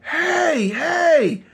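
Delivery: high-pitched retold holler. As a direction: holler out for help, drawn out. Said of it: "he starts hollering".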